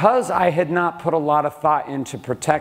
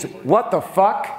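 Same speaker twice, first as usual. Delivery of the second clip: slow, drawn-out disbelief, pitched up; incredulous what the fuck; 5 semitones higher, much slower than usual. Retold or imitated. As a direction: stretch it out in stunned outrage. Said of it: "it means".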